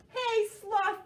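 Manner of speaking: high, loud group call. Acted. Animals calling Sloth, high and loud. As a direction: call across the jungle. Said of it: "they called".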